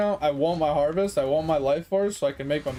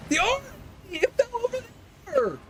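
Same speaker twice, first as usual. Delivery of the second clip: much higher, crying out in dismay; frantic yell. Real, not acted. Streamer losing the boss arm, high dismayed yell. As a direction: yelp it as the loot slips away. Boss's arm falls out of reach.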